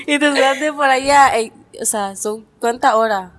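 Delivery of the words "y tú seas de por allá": habla entre risas; riendo. Real, not acted. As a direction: suelta la frase riéndose. Se ríe mientras habla. (riendo mientras habla)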